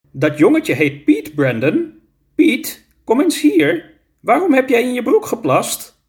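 high, lightly acted teacher voice; a hint of a teacher calling a pupil over; teacher questioning a child. A narrator's reading. Teacher asks little Piet about his wet trousers, lightly acted high voice.

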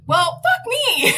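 high, slow swear; high drawn-out resigned swear; resigned curse. Retold or imitated. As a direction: give up and curse. Cursing at guests.

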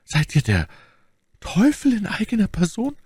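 high-pitched, haltingly acted lady; aghast stammer. A narrator's reading. Milady aghast at Athos's knowledge, high faltering voice. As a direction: falter once, then climb into a high horrified question. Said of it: "wurde leichenfahl und stammelte".

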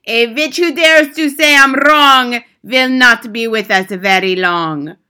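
put-on loud accented curse, sinking to a slow close; Grand High Witch reciting. A narrator's reading. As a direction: pronounce doom in rhyme. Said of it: "continued with her terrible recital".

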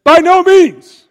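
high, loud, slow cry of refusal; a forceful high-pitched "By no means"; emphatic shout. Retold or imitated. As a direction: shout it down as Paul would. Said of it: "Paul very emphatically says".

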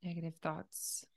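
calm, unhurried meditation leader; guided meditation. Acted. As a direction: gently guide a listener through breathing, leaving space between phrases.

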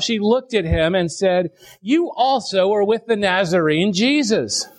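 measured, solemn Bible reading, slowing into the weeping; scripture reading. Acted. Pastor reads the Gospel to his congregation, solemn reading. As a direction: read the passage like a pulpit lesson.

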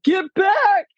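high, sharp mock shout; Dad's "get back". Father warning his sons, a high mock yell. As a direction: bark it at the kids. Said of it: "Dad's like".

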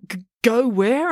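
high, slow stammering question; nervous stammer. Acted. Joe nervous.

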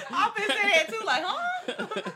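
very high, loud, slow laughing. Real, not acted. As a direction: crack up while talking. Laughing hard.

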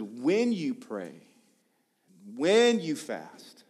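"when" drawn out long, then the rest falls; slow, deliberate emphasis on "when"; stress on WHEN. Retold or imitated. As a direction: lean slowly and hard on "when" each time.

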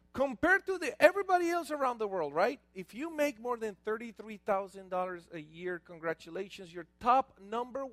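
ordinary delivery

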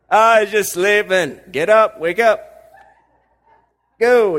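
loud, slow, breezy Jesus voice; brushing-off Jesus. Retold or imitated. Jesus brushing off the mourners, loud and breezy. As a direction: wave off the grief loudly and lightly. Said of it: "almost seems insensitive".

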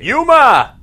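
calling out a name, loud. Retold or imitated. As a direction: yell the name across a room. Imitating the movie's shouted cat name.

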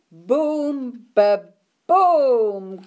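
loud booming thunder noise; big ba-boom of thunder. A narrator's reading. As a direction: let the thunder crash out. Thunder sound effect in story.

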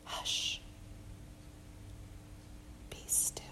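hushed whisper, calming the storm; barely audible whisper. Acted. Jesus speaking to the storm, soft whisper. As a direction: breathe it out so the sea stills. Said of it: "the barely audible, attention-grabbing whisper".

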